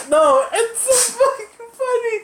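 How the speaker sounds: high, loud and laughing through it; can't stop laughing. Retold or imitated. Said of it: "And I was like".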